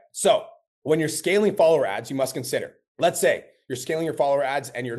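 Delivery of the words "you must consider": usual speaking voice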